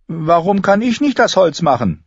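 high-pitched indignant question; voiced young girl, put out; protesting. A narrator's reading. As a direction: complain like a girl denied the better job. Girl protesting her kitchen chore.

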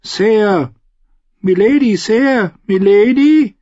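put-on frightened boy calling his lady from far off; timid calling. A narrator's reading. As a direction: call her again and again, growing worried. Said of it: "Seine Stimme klang zaghaft".

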